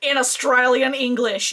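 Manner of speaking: big cartoonish Aussie accent; exaggerated Australian accent. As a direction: ham up the Australian accent, mocking the recording. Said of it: "that's exactly how we sound".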